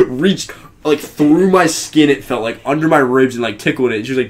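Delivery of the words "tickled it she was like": normal talking voice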